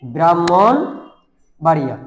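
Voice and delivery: ব্রাহ্মণবাড়িয়া drawn out slowly, "brammonbaria" with doubled m; teacher stretches ব্রাহ্মণবাড়িয়া for the class; slow model pronunciation. Shown as a demonstration. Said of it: "কি?".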